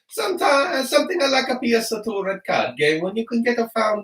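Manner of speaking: put-on high, loud comic Italian accent. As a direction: read it out like an over-the-top Italian. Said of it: "when I read it in the Italian accent".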